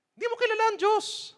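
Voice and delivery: very high falsetto put-down; mock high scolding. Preacher mocking a self-righteous accuser in falsetto.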